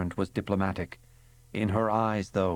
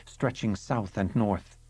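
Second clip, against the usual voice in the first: plain reading voice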